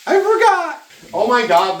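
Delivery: high loud startled yelp; high surprised cry. Real, not acted. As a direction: blurt it out startled. High loud sudden exclamation.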